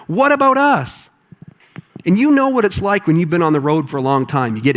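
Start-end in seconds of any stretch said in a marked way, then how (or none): 0.1-1.1 annoyed disciples. Acted. Disciples whining to Jesus about their own needs. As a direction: moan like fed-up, hungry followers. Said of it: "out of their minds annoyed".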